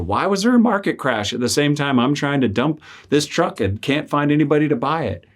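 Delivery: high, pressed, pleading questions; pleading complaint to God. Retold or imitated. Man venting to God about his unsold truck, exasperated and high. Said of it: "I'm like".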